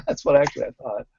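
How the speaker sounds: ordinary delivery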